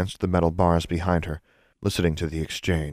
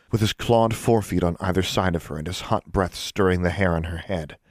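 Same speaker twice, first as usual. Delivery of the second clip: usual speaking voice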